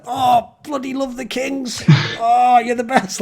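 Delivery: high, slow, drawn-out fawning; mock fan gushing. Californian fan switching to the Kings, mock fan gushing. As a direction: swoon over the team, sending up a fickle fan. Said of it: "Then two years later".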